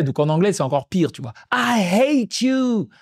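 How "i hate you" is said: put-on high, very slow whining child; imitating a toddler. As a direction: stretch out each word like a sulking toddler. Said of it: "En plus, en anglais".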